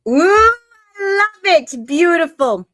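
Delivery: high, loud burst of delight; delighted outburst. Real, not acted. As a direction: squeal it out, thrilled, then ease down. Delighted reaction to reading.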